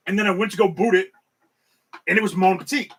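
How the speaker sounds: ordinary talk, nothing marked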